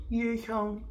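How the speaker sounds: put-on high, hesitant childlike reply; shy kid saying his name. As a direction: say it like a nervous eight-year-old on day one.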